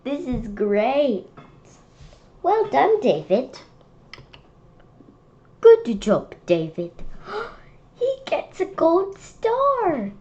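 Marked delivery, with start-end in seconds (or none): 2.4-6.9 praising teacher. Acted. Kid as teacher, loudly praising David for clean desks. 8.0-10.1 gasp, then a high slow delighted line; excited gasp. Real, not acted. Kid gasping with delight at David's gold star.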